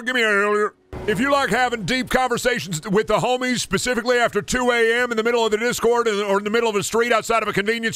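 high-pitched wrestler promo voice; hyped catchphrase rant, every phrase held high; Stone Cold. Acted. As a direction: cut a pumped-up wrestling promo, strained and high. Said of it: "Stone Cold don't want no Elden Ring".

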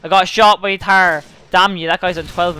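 real outburst, high and loud; frustrated shout. Real, not acted. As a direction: cry out in frustration. Killed in the game.

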